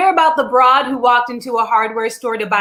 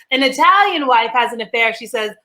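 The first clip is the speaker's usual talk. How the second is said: normal talking voice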